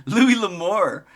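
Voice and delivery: ordinary delivery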